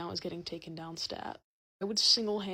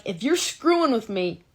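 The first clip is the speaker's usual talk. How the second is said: suspicious teacher; 5 semitones higher, 13 dB louder than usual. Retold or imitated. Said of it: "the teacher's, like, suspecting things".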